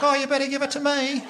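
very high mock female lover; foreplay woman. Young woman to her boyfriend through the wall, mock foreplay. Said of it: "and she's like".

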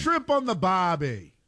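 slow, high hammed-up Australian accent. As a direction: drawl it like a cartoon Aussie. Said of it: "That's an Australian accent".

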